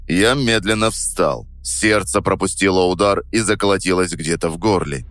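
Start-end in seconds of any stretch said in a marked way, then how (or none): none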